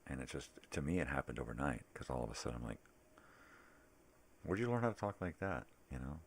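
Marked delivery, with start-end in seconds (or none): 4.4-5.7 high, loud disbelieving question; retold indignant outburst; exclaimed challenge. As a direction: pounce on the question, loud and high, then drop. Indignant parent reacting to kid's speech.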